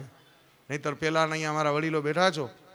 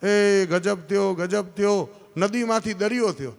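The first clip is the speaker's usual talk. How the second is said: raised, higher astonished yell; crying out; 4 semitones higher, 5 dB louder than usual. Acted. Priest wakes beside the sea and cries out in amazement. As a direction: holler in alarm, drawing out the first cry. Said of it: "મહારાજ રાડો પાડે".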